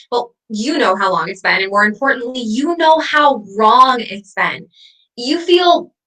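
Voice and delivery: ordinary talk, nothing marked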